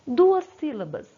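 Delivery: Duas sílabas said loud, with the word duas punched; teacher stressing a key word. Shown as a demonstration. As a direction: hit the word duas hard. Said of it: "O di vem de duas sílabas".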